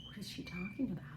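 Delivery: whispered parrot thought; voicing the parrots. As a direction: hush it like a suspicious bird. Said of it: "they stop, like".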